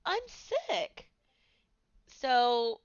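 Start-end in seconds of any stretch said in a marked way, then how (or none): 0.0-1.1 high, whiny put-on voice for the sick moment; mock sick voice. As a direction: squeak it out like you're suddenly unwell. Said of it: "and I'm like".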